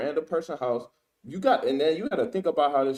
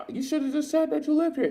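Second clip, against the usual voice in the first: high mocking voice of the woman; mimicking her question; 13 semitones higher than usual. Commentator quoting the woman asking the homeowner, high mock voice. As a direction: ask it in her suspicious tone, pitched up. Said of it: "she said".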